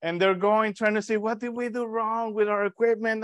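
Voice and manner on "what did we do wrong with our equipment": baffled doctors. Retold or imitated. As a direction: play the doctors scratching their heads. Doctors baffled by broken equipment.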